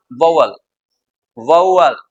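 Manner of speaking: "वावल, वाउअल" each as VOW-ul, low falling end; correct "vowel". Shown as a demonstration. Teacher repeats the model, "वाउअल" said as VOW-ul. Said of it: "ऐसे बोलते हैं इसको".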